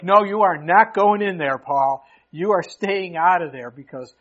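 loud, emphatic mock order; imitating friends laying down the law; firm forbidding. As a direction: put your foot down, loudly. Friends forbid Paul from going in.

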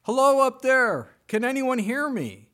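loud, high call up the cliff; yelling up for rescue; scream for help. Retold or imitated. Jack screaming up from a cliff branch, loud high call. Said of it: "So he began to scream".

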